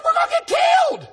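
high panicked yell; alarmed shout. Retold or imitated. Retelling his panic to the congregation, high alarmed shout. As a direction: cry out in alarm at the danger. Preacher retells fear of bikers.

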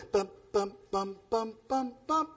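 soft, steady "bum, bum"; imitated gentle cymbal taps. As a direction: tap out a timid beat under your breath. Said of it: "he plays with the congregation".

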